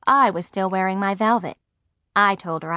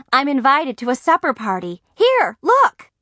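higher, eager, climbing to a loud cry; excited; 6 semitones higher, 4 dB louder than usual. A narrator's reading. Margaret shows her mother the invitation, excited. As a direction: show off the news eagerly.

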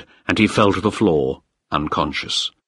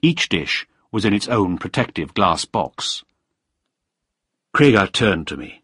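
ordinary delivery, nothing marked

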